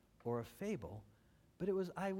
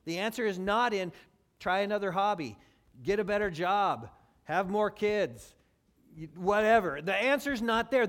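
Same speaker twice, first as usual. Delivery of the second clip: loud, high preaching; preaching at full force; 4 semitones higher, 9 dB louder than usual. Acted. Preacher pushing loudly at the church. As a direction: press the point hard to the congregation.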